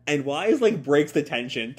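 normal talking voice

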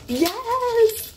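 high, elated cheer; real excitement. Real, not acted. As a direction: let out a high fangirl cheer. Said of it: "I'm so excited".

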